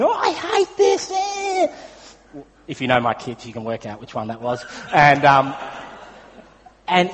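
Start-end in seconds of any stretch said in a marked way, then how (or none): 0.0-1.7 put-on frustrated kid scream. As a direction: shriek it like a fed-up kid in a spinning dinghy. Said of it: "one of them was screaming".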